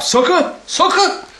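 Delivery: very high, squeaky playful repeats; silly high voice. Pretend. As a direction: pitch it way up for a laugh. Clowning repeat of the slang word.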